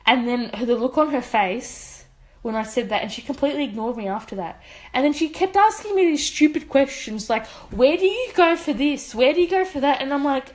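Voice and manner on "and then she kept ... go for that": higher, mock-chirpy survey questions; mocking surveyor. Mocking the surveyor's stupid questions to her friend, higher mock voice. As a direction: parody her nagging questions.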